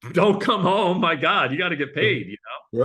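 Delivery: loud, high push to stay; wife scolding. Retold or imitated. Wife on phone urging him to stay, loud. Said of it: "She goes".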